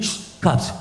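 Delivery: sudden unvoiced shush; paranoid hush. Comic on drugs warning of cops, sudden hush. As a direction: cut off and hush in alarm.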